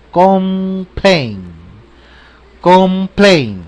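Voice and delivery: complain, clear m before p; complain, closed-lip m. Shown as a demonstration. Teacher repeating the word for practice. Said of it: "Labios cerrados con la M".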